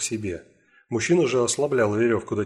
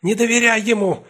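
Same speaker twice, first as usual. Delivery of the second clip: high, loud panting warning; breathless shout; 12 semitones higher, 6 dB louder than usual. Acted. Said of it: "задыхаясь, крикнула".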